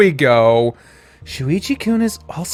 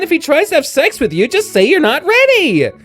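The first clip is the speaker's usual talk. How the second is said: high, loud, exasperated back-and-forth bickering; high yelling girl voice, trading insults; high-pitched flustered girl; 11 semitones higher, 4 dB louder than usual. Acted. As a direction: squeal and scold like an embarrassed best friend. Arguing girl character, held voice.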